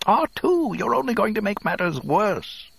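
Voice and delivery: incensed droid, very high-pitched; shrieky, indignant reprimand; Three P O. A narrator's reading. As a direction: scold in a high, fussy robot voice. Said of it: "Three P O was incensed".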